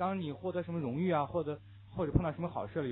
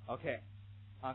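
ordinary talk, nothing marked

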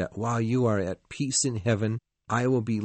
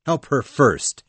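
loud command; 4 semitones higher, 8 dB louder than usual. Acted. Heathcliff commands the husband.

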